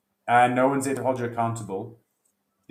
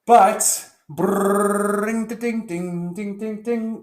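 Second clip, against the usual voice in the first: much higher, louder sung ding-ding jingle; mock intro jingle; 9 semitones higher, 6 dB louder, much slower than usual. Host kicking off his podcast intro with a mock jingle. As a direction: belt out a silly intro sting.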